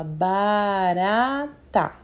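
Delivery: "barata" said slowly, every syllable clear; teacher models "barata" slowly and clearly; slow clear word. Shown as a demonstration. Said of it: "a gente fala".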